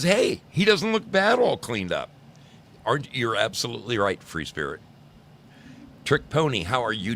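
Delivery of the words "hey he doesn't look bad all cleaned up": quoted exclamation. Retold or imitated. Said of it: "See, she says".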